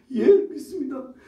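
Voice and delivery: a loud, high, emphatic yes of faith; eager confession. Acted. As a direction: declare it boldly, voice lifted.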